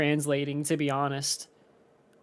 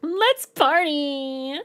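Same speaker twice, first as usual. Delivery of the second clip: put-on party girl voice; 8 semitones higher, much slower than usual. Imitating Toy Chica catchphrase.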